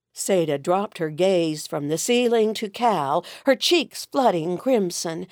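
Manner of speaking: plain reading voice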